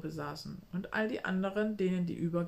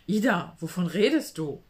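startled; 7 dB louder than usual. Acted. Startled friend exclaims.

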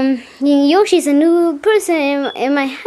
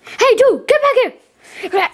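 high yell; 9 semitones higher, slower than usual. Pretend. Yelling after fleeing character.

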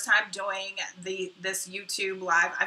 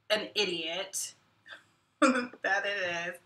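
ordinary talk, nothing marked

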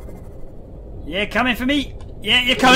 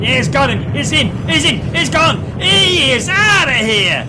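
higher and louder, gleeful; excited cheering; 5 semitones higher, 5 dB louder than usual. Real, not acted. Player cheering as the creature is dragged away, loud gleeful whoops. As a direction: cheer it on like a goal.

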